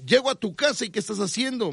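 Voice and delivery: lightly voiced probing host; mock interviewer. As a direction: ask it like a baffled host.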